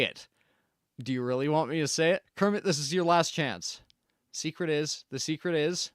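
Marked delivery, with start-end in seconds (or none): none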